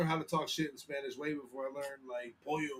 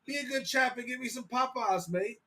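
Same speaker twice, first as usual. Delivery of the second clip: high-pitched British chap; mock British; 7 semitones higher than usual. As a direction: put on a jolly British accent. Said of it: "talk in Spanish with a British accent".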